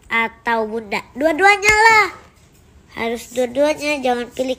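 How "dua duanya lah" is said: high and loud, both of them; bright eager answer; eager exclamation. Real, not acted. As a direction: blurt it out, eager. Kid answers eagerly, both parents.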